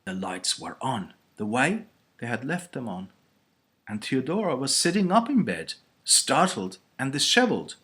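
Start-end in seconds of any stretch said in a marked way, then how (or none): none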